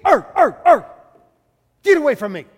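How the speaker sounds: loud, high snarling bark at the gate; barking dog. Acted. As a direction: bark it out like a cornered pound dog.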